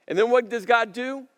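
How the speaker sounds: loud, rising preacher's drive; preaching. Acted. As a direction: build the sermon to full force. Preaching at full force.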